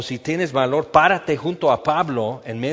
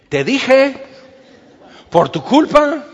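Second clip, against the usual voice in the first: high, loud nagging told-you-so; mocking I-told-you-so; 10 semitones higher, 8 dB louder, slower than usual. Preacher mimics someone blaming you, high and loud and smug. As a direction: rub it in like a smug know-it-all. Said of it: "alguien diga".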